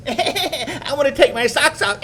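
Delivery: put-on high nagging child's whine through laughter; whiny little girl. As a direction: whine it like a stubborn four-year-old. Mimicking whining child.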